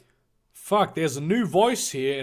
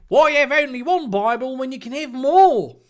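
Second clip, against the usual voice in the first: high, loud put-on character voice; loud, high-pitched boy debating; guffawing Ronald; 11 semitones higher, 6 dB louder than usual. Plays Ronald arguing over breakfast.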